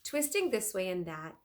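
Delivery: ordinary delivery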